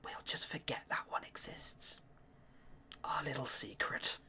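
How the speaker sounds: conspiratorial whisper; hushed aside to the audience. Real, not acted. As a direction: whisper it like a secret. Aside after choosing dialogue.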